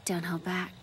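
breathy threatening whisper; menacing whisper. Acted. Assassin girl warning her opponent, in a whisper. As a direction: hiss it low as a warning.

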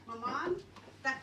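normal talking voice

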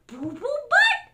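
high, loud BOO BOO BUTT swooping up; silly name. Acted. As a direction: announce "Boo Boo Butt" goofily. Book gives hippo silly name.

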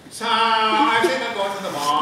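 put-on high, slow, airy valley girl drawl. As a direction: play a bored mall-going teen. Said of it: "I always heard Chell like this".

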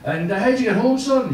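high-pitched, unimpressed Irish dad; lightly voiced father. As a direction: ask your son, high and mildly incurious. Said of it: "he's telling his dad".

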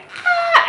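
high, loud shriek of joy; shrieking with disbelief at the test; excited scream. Retold or imitated. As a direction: let out a thrilled scream. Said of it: "I screamed so loud".

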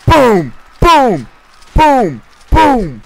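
high, loud boom with each shot; gleeful booms. Real, not acted. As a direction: shout boom as you fire. Shooting targets in game.

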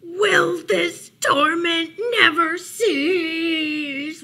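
loud, high, slow anguished wail; wailing bear. A narrator's reading. Bear tormented by Mouse's noise, drawn-out wail. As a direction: wail it out, stretching the words. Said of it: "wailed Bear".

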